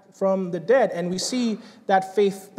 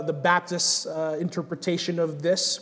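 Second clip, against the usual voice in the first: usual speaking voice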